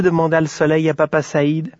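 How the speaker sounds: plain reading voice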